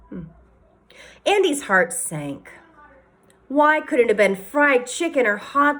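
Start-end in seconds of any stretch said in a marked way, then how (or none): none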